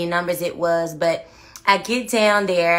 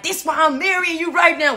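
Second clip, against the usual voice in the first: gangster fiancé; 9 semitones higher than usual. Retold or imitated. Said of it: "he stood over me and like".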